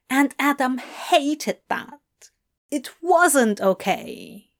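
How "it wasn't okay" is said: usual speaking voice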